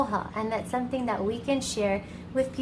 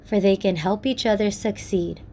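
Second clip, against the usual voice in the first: gentle, measured storyteller close; story narration; 6 dB louder than usual. A narrator's reading.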